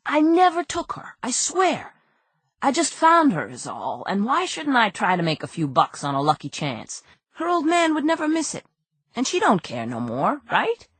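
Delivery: high, pleading small-time crook; a nervous crook begging his innocence; whiny crook voice. Acted. Nervous crook pleading with detective Andy, high and whiny.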